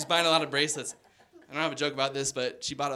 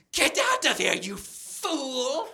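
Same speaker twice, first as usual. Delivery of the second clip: high, loud mock yell; hammed-up old lady yelling; grandma yelling; 9 semitones higher, 3 dB louder, much slower than usual. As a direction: shoo him out furiously like a cranky old lady. Grandma attacking with wheelchair.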